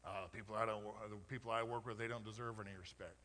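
low, muttered grumble of a resentful worker; mock dismissive quote. Pastor mimicking a worker who resents coworkers, low grumble.

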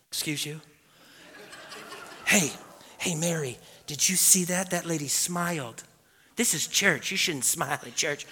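mock hushed scolding in the pews; hushed disapproval. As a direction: softly imitate a scandalized churchgoer. Mimicking stuffy, silent congregation members.

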